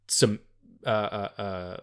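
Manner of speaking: ordinary talk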